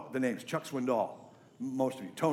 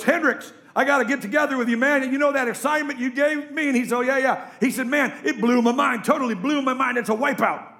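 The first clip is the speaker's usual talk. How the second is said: excited football player; 10 semitones higher, 8 dB louder than usual. Retold or imitated. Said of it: "and he goes".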